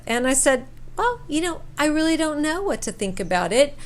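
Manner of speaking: usual speaking voice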